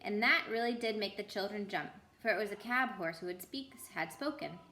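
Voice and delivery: usual speaking voice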